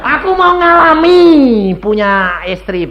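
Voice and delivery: loud, high, drawn-out plea; comically insistent begging; insistent mock pleading. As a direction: plead loudly, stretching it out like a big wail. Acted pleading with God to marry.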